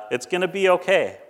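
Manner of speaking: a hopeful promise, pushed out; emphatic reassurance. Retold or imitated. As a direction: say it out boldly to the city. Reading garbage man's tweet.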